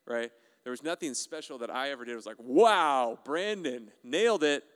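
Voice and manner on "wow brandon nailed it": loud, drawn-out mock praise; mock amazement. Preacher imagining praise for his own efforts, mock amazement. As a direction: stretch the wow, hammed-up admiration.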